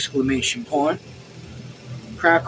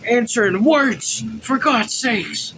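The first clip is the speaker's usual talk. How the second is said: slow, raised, forceful demand; angry shout; 6 semitones higher, slower than usual. A narrator's reading. Officer berating silent servant Joseph, angry shout. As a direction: demand an answer, drawing out each word. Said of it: "and he shouted".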